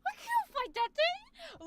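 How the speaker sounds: high, squeaky mock panic; comedic anime panic. Streamer picturing the actor facing a demon, comedic anime panic. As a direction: squeal it like a cowering anime character. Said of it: "in a comedic anime where you guys".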